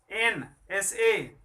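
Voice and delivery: एन एस ए spelled very slowly; slow letter by letter. Shown as a demonstration. (एन एस ए धीरे-धीरे)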